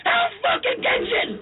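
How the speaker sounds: high, drawn-out exasperated mock shout; exasperated mock yell. Lady repeating it to a baffled contestant, exasperated mock yell.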